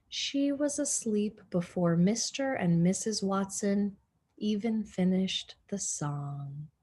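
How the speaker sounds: ordinary delivery, nothing marked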